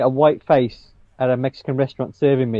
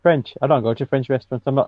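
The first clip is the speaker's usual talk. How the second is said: ordinary talk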